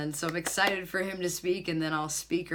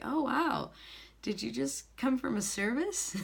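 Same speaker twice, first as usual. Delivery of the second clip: higher-pitched wondering question; mildly amazed, voiced as someone else; retold surprise; 8 semitones higher than usual. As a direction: imitate an impressed, puzzled clerk.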